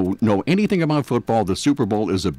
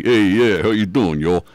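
put-on loud New York accent with Southern "y'all"; 3 semitones lower, 5 dB louder than usual. Joking how catchy Southern talk is, New York accent y'all greeting. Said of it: "a Southern accent is really catchy".

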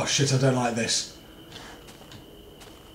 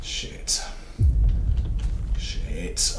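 hushed, voiceless swearing; whispered curse; 9 semitones lower, 3 dB quieter than usual. Real, not acted. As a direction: swear under your breath. Frightened muttering in horror game.

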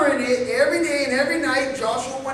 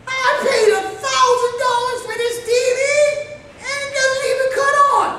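a buyer griping, high and fast, about his dead TV; frustrated customer; 9 semitones higher than usual. Retold or imitated. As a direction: rant like you got ripped off.

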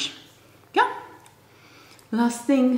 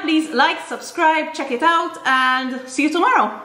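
higher, upbeat sign-off; cheerful outro; 8 semitones higher than usual. Real, not acted. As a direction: sing out the goodbye brightly.

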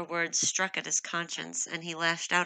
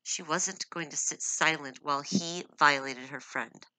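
ordinary delivery, nothing marked

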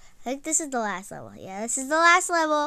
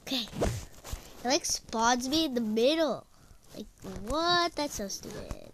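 ordinary talk

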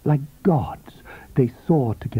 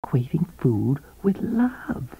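higher, knowing, silvery woman's voice; 7 semitones higher than usual. A narrator's reading. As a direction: coax him like a confidante. Coral's voice in the story.

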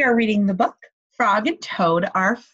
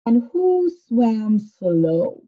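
drawn out question; 4 dB louder, much slower than usual. Shown as a demonstration. Teacher drawing out the question.

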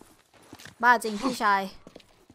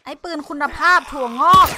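1 frustrated outburst; 4 semitones higher, 11 dB louder than usual. Real, not acted. Frustrated with a weak in-game gun.

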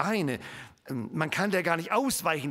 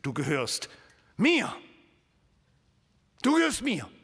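"mir" punched higher and louder; emphatic claim; 4 semitones higher, 4 dB louder than usual. Acted. God declaring ownership of Moses, emphatic on "mir". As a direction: stamp down the last word. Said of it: "Aber hier heißt es".

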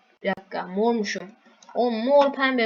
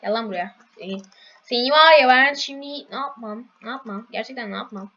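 ordinary talk

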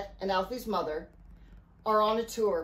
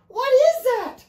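very high, loud cry of shock; alarmed shout; 10 dB louder, slower than usual. A narrator's reading. Riley sees the sea serpent, alarmed shout. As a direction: shout it in horror. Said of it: "Riley shouted".

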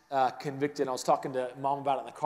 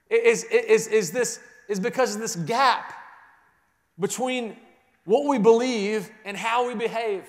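loud, high, building sermon voice; full-voiced pulpit preaching; preaching at full force; 6 semitones higher, 6 dB louder than usual. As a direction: preach it out to the room with rising force.

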